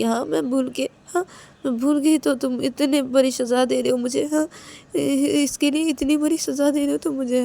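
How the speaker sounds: high-pitched wounded pleading; hurt, tearful Ananya. Acted. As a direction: protest the punishment, close to tears. Ananya's hurt plea to Payal. (रुआँसी, ऊँची आवाज़ में शिकायत भरी मिन्नत)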